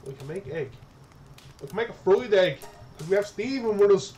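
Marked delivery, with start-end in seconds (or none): 1.6-4.1 high and loud with excitement; suddenly thrilled by the idea; excited. Real, not acted. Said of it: "repeats it louder".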